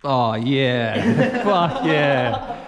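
loud, laughing cheer; real delighted laughter through the words; laughing excitedly. Real, not acted. Thrilled at a great find.

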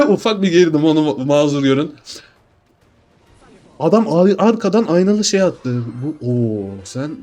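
ordinary delivery

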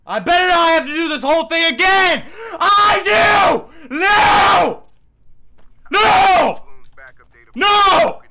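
high screaming no; anguished scream. Real, not acted. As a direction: wail in despair at the game. Failed mission, restart.

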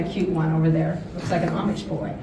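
ordinary delivery

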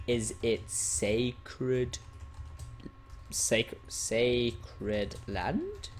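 very slow, broken-up, rising at the end; slow typing read-out. Real, not acted. Player types question about banned area, very slow read-out. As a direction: sound out each syllable as you type.

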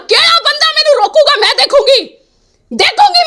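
high-pitched, heated challenge; real indignant anger, rising high; angry defiance. Real, not acted. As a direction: dare them, voice pitched sharply up. Defiant challenge during angry tirade.

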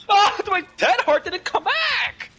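high, slow, loud wail; dismayed high cry. Real, not acted. Dismayed outburst in game.

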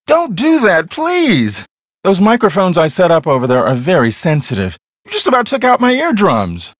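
annoyed scolding. Acted. Scolding the piano player for hurting his ears, annoyed. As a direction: tell them off for the noise.

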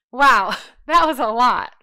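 loud, laughing exclamation; amused relief. Real, not acted. Teacher after finishing a long sample answer, laughing relief.

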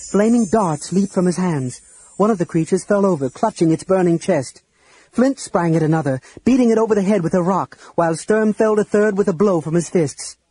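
ordinary delivery, nothing marked

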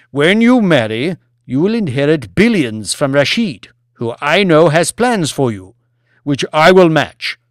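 loud, forceful harangue; demanding lord. Acted. Lord demands Banks look after the people, loud harangue. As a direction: drive each point home loudly. Plays Lord Martin promising inheritance.

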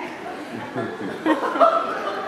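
low airy chuckle; quiet breathy chuckle; breathy laugh. Real, not acted. Preacher chuckles about the pictures hidden in the box, breathy laugh.

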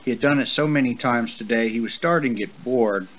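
plain reading voice

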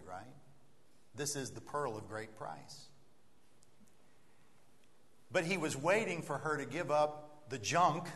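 ordinary talk, nothing marked

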